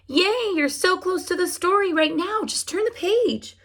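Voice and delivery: high excited voice cheering the listener on. Acted. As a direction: cheer them to turn the page. Cheering voice for the child.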